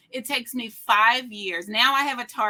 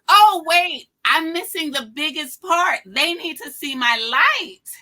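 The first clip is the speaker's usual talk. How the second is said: sudden bright burst of mock revelation, high and loud; an excited aha about her own younger self; excited realization; 7 semitones higher, 9 dB louder than usual. Retelling her younger self's excited realization at QVC.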